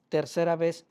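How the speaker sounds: ordinary talk, nothing marked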